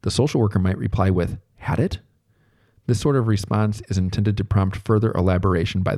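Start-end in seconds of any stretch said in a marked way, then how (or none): 1.6-2.0 "Had it?" echoed as a quizzical question. Shown as a demonstration. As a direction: echo "Had it?" back like a question. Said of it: "repeating in a questioning tone of voice".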